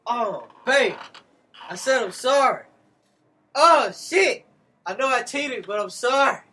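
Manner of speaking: loud, begging apology up high; loud pleading apology. Retold or imitated. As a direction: plead loudly for forgiveness. Acting out [name] at her job.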